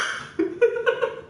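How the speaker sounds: high wheezing laugh. Real, not acted. Laughing at his image.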